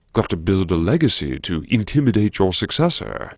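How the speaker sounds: usual speaking voice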